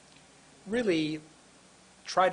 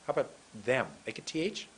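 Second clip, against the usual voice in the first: ordinary talk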